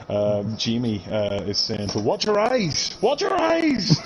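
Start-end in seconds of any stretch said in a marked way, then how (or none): 1.9-4.0 high, mock-shouted chant; capital-letters shout. Reading a viewer's all-caps comment aloud, mock shouting and rising higher. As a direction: read the comment as if yelling it. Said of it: "in capital letters".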